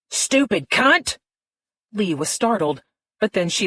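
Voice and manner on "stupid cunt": angry insult. A narrator's reading. Phil insults the cat name.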